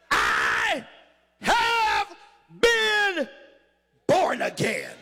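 "I... have... been..." each held high, then "born again" dropped; word-by-word proclamation. Real, not acted. Preacher declaims the key phrase.

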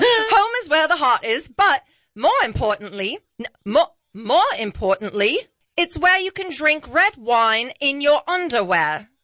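put-on British with a high, lilting start; careful amateur British accent, slightly Australian. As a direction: read it out in mock British. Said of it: "I'm British".

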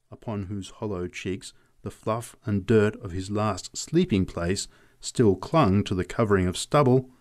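plain reading voice

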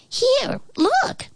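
very high squeaky voice; flea voice. A narrator's reading.

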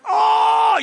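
high, loud yelp of pain; shout of pain. Retold or imitated. Dad yelps loudly as his cut is poked. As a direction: let out a sudden loud yelp. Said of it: "I'm like".

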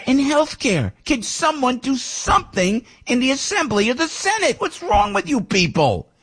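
high, exasperated tirade; fired up. Real, not acted. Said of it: "This is Piscopo fired up".

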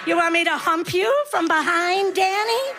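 hammed-up hard-of-hearing granny; put-on deaf old woman, building to high-pitched calling; pretending to be deaf. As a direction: mishear and answer back, voice climbing high. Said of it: "I pretend I'm deaf".

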